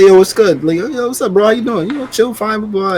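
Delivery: high and bright street greeting; friendly greeting. Retold or imitated. Marylander imitating friendly southern greetings, high. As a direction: mimic the chatty southerner. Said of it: "He's like".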